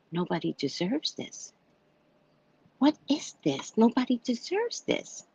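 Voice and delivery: high, quiet incredulous plea; can't believe what she just heard; incredulous disbelief. Retold or imitated. To her neighbor John, quiet and high in disbelief.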